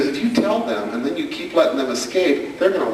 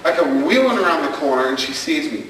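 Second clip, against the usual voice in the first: ordinary talk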